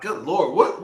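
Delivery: usual speaking voice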